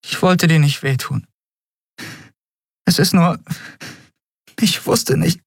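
high, halting, close to tears; stockende Entschuldigung. Acted. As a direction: break off and search for words, upset. Tearful apology to Kai. (hoch und stockend, den Tränen nah)

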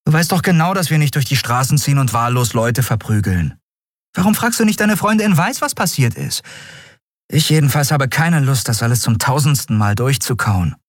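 high-pitched, fed-up protest; exasperated arguing. A narrator's reading. Prisoner fed up with the admiral's questioning, exasperated. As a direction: argue back, tired of explaining it.

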